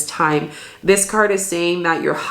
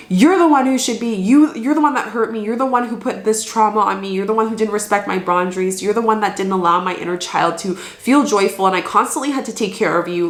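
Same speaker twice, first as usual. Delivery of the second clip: higher, accusing rush of blame easing as it goes; victim voice; 4 semitones higher than usual. Acted. Inner victim blaming the one who hurt them, accusing. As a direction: pour out the hurt as the wounded victim. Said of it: "That's the victim voice".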